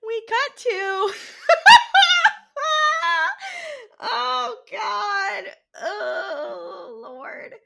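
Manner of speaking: helpless laughter; real laughing fit, gasping for air; laughing fit dying down. Real, not acted. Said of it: "laughing fit".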